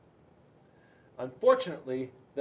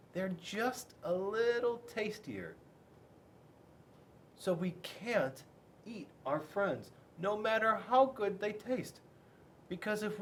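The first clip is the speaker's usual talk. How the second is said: gentle dad; 5 semitones higher, 3 dB quieter than usual. A narrator's reading.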